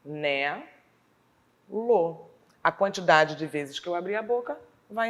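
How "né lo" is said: "chi... né... lo" with long pauses between; separated syllables. Shown as a demonstration. Teacher separating the word for pupils, syllable by syllable. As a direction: hold each syllable, then stop. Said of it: "Na hora de separar as sílabas, eu vou separar".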